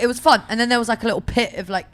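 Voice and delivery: ordinary talk, nothing marked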